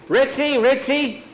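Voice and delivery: high loud call; calling the dog. Acted. Boy runs behind the house calling his dog, high shout.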